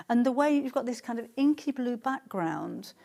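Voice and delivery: ordinary talk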